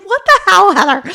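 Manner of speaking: high, loud pretend exclamation; mock listener outburst. Imagined listeners calling out to Heather, mock high loud outrage. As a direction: shout it as a baffled podcast listener. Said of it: "the people are probably like".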